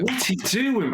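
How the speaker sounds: high, exasperated cry. Retold or imitated. Fan reliving his anger at Jackson's hesitation, exasperated and high. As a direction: throw the question up in disbelief. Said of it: "that's how angry I was".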